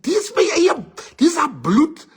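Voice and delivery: high whiny insistence; insistent high protest. Retold or imitated. Friend insists the girl is family, high insistent. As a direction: squeak it out like a guilty lover.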